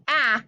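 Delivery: short vowel "ah", as in ask; short A sound. Shown as a demonstration. Teacher modelling for pupils, short A "ah". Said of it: "cause it's short".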